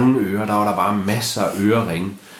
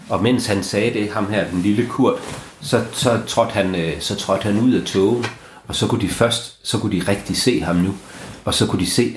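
usual speaking voice

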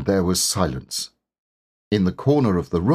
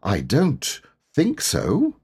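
slow, high, meek answer; drawn-out, hesitant humility; humble; 6 semitones higher, slower than usual. A narrator's reading. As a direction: deny it humbly and uncertainly. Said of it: "said Pooh humbly".

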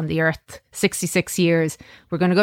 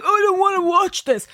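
put-on high, sulky kid voice; whiny child; 13 semitones higher than usual. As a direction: pout it like a bored child. Quoting reluctant eight-year-old son.

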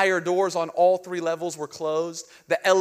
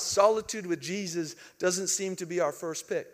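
normal talking voice

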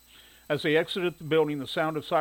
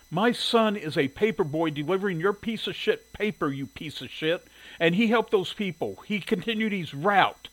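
higher-pitched, heated rebuke; angry interruption; 5 semitones higher, 3 dB louder than usual. A narrator's reading. Shirley cutting the editor off to defend her son, angry. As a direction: cut in, indignant and insistent.

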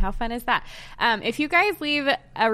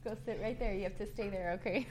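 much softer, turned away from the mic; 14 dB quieter than usual. Real, not acted. Mom quietly tells her son to sit, off mic.

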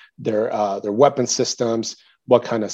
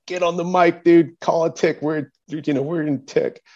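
higher-pitched mock commander's order; urgent retold order; 6 semitones higher than usual. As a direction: push it urgently, imitating the commander.

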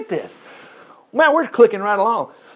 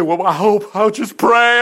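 mock timid prayer; 3 semitones higher, 4 dB louder, slower than usual. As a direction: play the faint-hearted soldier who only hopes. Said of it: "Notice he didn't say".

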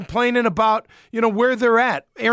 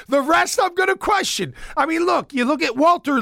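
amused outburst; 7 semitones higher than usual. Real, not acted. Laughing before questioning picks.

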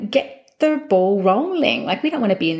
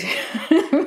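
short laugh; 8 semitones higher than usual. Real, not acted. Laughs after rejecting property.

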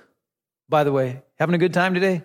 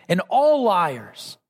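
slow, heavy stress on "all"; extra emphasis; slower than usual. Shown as a demonstration. Pastor warning the congregation, slow stress on "all". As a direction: draw out "all" and land on "liars". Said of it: "Extra emphasis on that".

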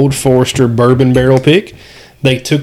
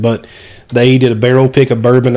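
ordinary talk

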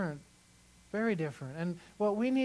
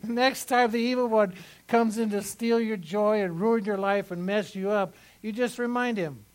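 louder preaching push; 3 semitones higher, 6 dB louder than usual. Real, not acted. Pastor urging the church to call on Jesus, loud preaching. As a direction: drive it home to the congregation. Preaching with force after joke.

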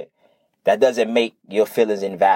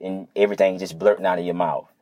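ordinary talk

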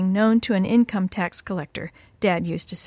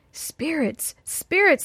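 frightened calling out; 6 semitones higher, slower than usual. A narrator's reading. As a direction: narrator acting out alarmed boys, pitch jumping up. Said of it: "they called out".

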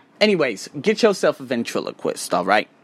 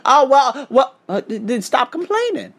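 flustered mom; 9 semitones higher than usual. Retold or imitated.

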